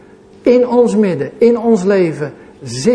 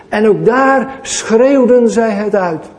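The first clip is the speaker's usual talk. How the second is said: ordinary delivery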